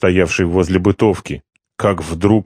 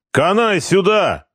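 much higher, slow bellow; shout; 12 semitones higher than usual. A narrator's reading. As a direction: shout him back. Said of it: "крикнул Харитонов".

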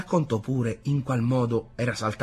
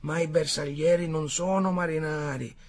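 higher-pitched, eager war tale; animated soldier storyteller; 9 semitones higher, 5 dB louder than usual. A narrator's reading. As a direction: relive the battle for the listeners. Soldier character tells his battle tale.

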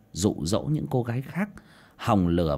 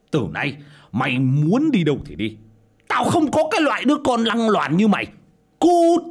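higher and louder, ending in a screamed "Cút!"; furious yelling; 11 semitones higher, 7 dB louder than usual. Acted. Father disowning his daughter, yelling in fury. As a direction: disown her with full rage. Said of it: "mà mắng xối mắng xả".

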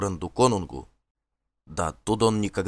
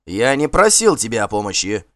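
louder, angry retort; angry shout; 3 semitones higher, 7 dB louder than usual. Acted. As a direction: bark it back in anger. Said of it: "гневно крикнул Асфальт". (гневно, громче крикнуть)

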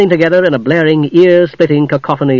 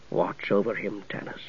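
solemn ghost knight; 6 semitones lower, 16 dB quieter than usual. Acted. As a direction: speak like a grave spirit.

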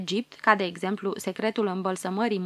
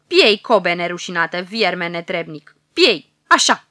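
loud furious roar; roaring in fury; 3 semitones lower, 8 dB louder than usual. Acted. Furious Pharaoh roars at the old prophet, hurling his lance. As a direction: roar with hatred as the lance flies. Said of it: "aruncă cu ură o lance ... răgnind".

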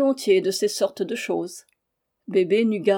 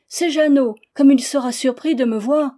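higher, louder eager exclamation; excited cry; 4 semitones higher, 6 dB louder than usual. A narrator's reading. Bébé spotting the woodcutter, crying out with excitement. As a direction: call out delighted at the sight. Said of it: "s'écria Bébé".

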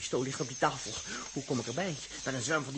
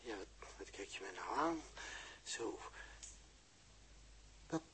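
soft bewildered murmur; 6 semitones lower, 17 dB quieter than usual. Acted. Dirk confused, speaking softly to Els.

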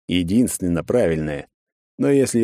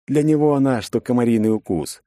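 usual speaking voice

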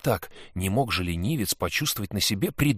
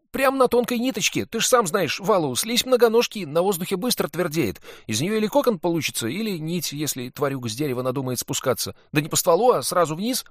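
put-on higher, louder excited boy; boy's voice; 9 semitones higher, 6 dB louder than usual. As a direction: play a cheeky lying boy. Sid keeps lying to Valu.

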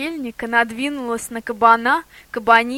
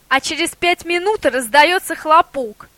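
sharp; 6 semitones higher, 4 dB louder than usual. A narrator's reading. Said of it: "резко сказала Афоня".

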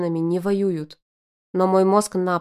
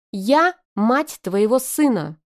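slow, loud, higher yell; 4 semitones higher, 5 dB louder, slower than usual. A narrator's reading. Arina yelling at the father of her son. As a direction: hammer each word, yelling. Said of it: "снова заорала она".

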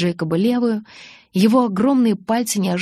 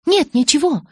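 high, loud, evasive brush-off; hasty denial; 9 semitones higher, 5 dB louder than usual. A narrator's reading. Bella stops short of confiding in Jacob, hasty denial. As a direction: back out of it hurriedly, pitch jumping up. Backs out of confessing, hiding trouble.

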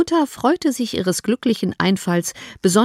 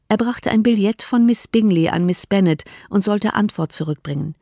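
ordinary delivery, nothing marked

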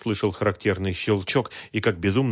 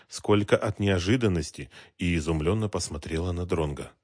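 ordinary delivery, nothing marked

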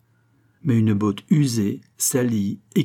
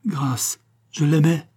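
terrified plea; 6 semitones higher, slower than usual. A narrator's reading. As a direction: plead desperately for mercy. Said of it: "la terreur domina chez la gitana". (supplication désespérée)

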